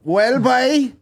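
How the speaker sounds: high, exaggerated Cork lilt; mock Cork accent. Mimicking an Irish coach greeting French players, high Cork accent. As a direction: put on a hammed-up Cork accent. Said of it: "Being all, like, fucking".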